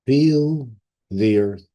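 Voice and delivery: usual speaking voice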